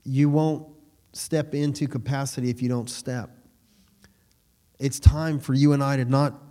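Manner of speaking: usual speaking voice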